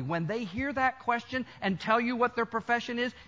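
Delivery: ordinary talk, nothing marked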